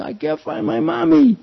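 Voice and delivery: put-on high, childlike wail; high pleading child voice; little lost boy. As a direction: voice yourself as a crying four-year-old. Child lost, retold crying.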